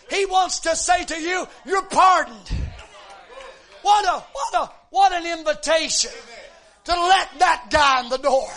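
loud, ringing preacher's cry; preaching at full force. Acted. Preacher exhorting the congregation, loud and rising. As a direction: lift the church with it.

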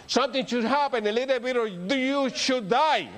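high, rising preacher's cadence; preaching. Acted. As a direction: preach it out to the congregation. Preaching on dying to the flesh.

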